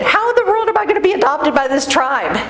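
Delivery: mock panic of a reluctant doctor; exasperated doctor. Doctor complaining to his assistant, high and disbelieving.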